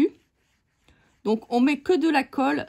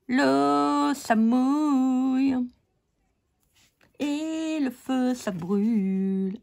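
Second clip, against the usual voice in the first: mock solemn; 2 semitones higher, much slower than usual.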